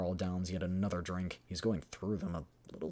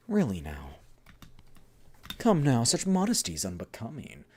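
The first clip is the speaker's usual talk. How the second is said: louder, theatrical mild reproach; teasing game character; 5 semitones higher, 11 dB louder than usual. Acted. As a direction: chide them playfully in a lifted voice. Acting out game dialogue.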